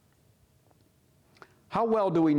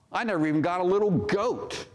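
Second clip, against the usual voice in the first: higher, aggrieved sulking; whiny complaint; 6 semitones higher, faster than usual. Acted. Older son grumbling to his father about the goat, higher and aggrieved. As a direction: pout it out like a cheated child. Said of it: "He's complaining".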